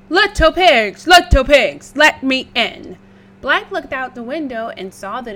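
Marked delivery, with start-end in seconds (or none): 0.1-3.0 loud big bad wolf call. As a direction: bellow through the door like a wolf.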